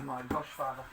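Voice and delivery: ordinary delivery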